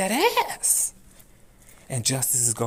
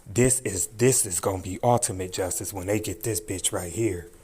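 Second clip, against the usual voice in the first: usual speaking voice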